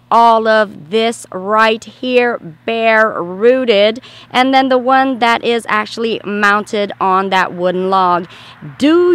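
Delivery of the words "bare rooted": slow, drawn-out bare-rooted; slow emphasis. Shown as a demonstration. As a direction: stretch out bare-rooted syllable by syllable.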